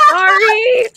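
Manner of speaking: loud, high burst of real laughter into a squealed sorry; laughing apology. Real, not acted. Player apologizing to the game master, laughing.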